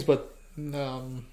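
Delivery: ordinary delivery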